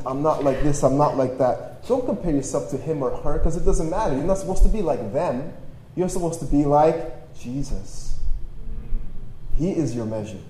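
ordinary talk

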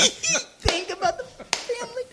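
repeats the line while laughing, slow. Retold or imitated. Repeating his own line to friends, laughing. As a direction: cracks up saying it again.

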